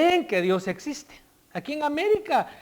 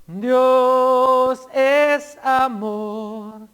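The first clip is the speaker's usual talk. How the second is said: louder proclaimed quote; 3 semitones higher, 6 dB louder, much slower than usual. Shown as a demonstration. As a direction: ring out "Dios es amor" slowly, with stress on "es".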